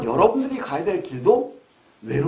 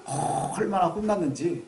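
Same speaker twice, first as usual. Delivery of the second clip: normal talking voice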